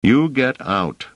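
slow, high-pitched, forceful command; vehement, measured demand; vehement order. A narrator's reading. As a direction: order the boy out, drawing the words out. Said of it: "shaking the boy vehemently".

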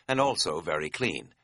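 usual speaking voice

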